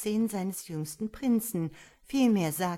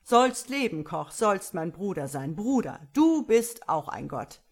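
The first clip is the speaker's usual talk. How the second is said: exultant cheer; 7 dB louder than usual. Acted. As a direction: cheer him with raised glass.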